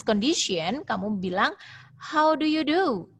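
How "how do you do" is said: "How do you do?" lifted high as a question; slow, high-pitched question tune on "How do you do?"; rising question intonation. Shown as a demonstration. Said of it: "Jadi naik ke intonasinya".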